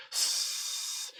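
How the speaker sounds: plain reading voice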